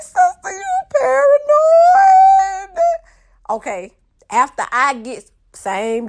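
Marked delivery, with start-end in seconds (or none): 0.0-2.8 imitated very high, loud, drawn-out shriek; shrill, helpless laughing squeal. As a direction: squeal it slowly as if cracking up. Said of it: "she can't hold it together".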